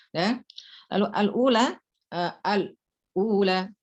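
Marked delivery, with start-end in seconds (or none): none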